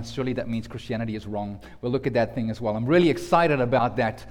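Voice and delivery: ordinary talk, nothing marked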